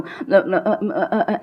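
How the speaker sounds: mock stammer on a repeated short sound; fumbling. Shown as a demonstration. Teacher shows students getting stuck on stage, fumbling. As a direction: stumble on little broken "uh"s as if stuck. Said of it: "मैं फ्लम-, fumble करूँ".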